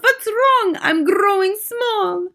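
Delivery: put-on very high squeaky boy; mock distress, far above normal pitch; alarmed cry. A narrator's reading. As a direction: mimic a child's panicked squeal. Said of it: "cries out".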